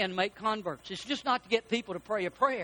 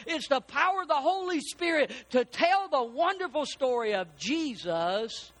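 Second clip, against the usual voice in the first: higher, emphatic pulpit preaching; 5 semitones higher than usual. Real, not acted. Preacher tells congregation of the Spirit's power, preaching.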